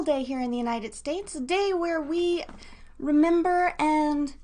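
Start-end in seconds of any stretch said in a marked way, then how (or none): none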